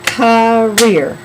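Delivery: Career loud and split, "cuh-reer"; syllable demonstration. Shown as a demonstration. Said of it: "Let's try it".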